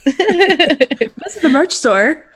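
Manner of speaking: laughing through a merch plug; giggling ad read. Real, not acted.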